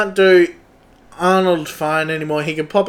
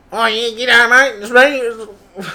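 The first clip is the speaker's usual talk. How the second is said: a hint of a spaced-out Aussie junkie; lightly voiced strung-out bloke saying g'day; 4 semitones higher than usual. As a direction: try the new character as a junkie, lightly voiced. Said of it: "that was like a junkie".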